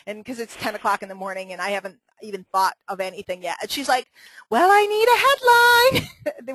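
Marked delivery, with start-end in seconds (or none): 4.5-6.5 higher, louder, slow mock Kathy voice; drawn-out high caricature of Kathy; imitating Kathy. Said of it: "Was that better? It was closer".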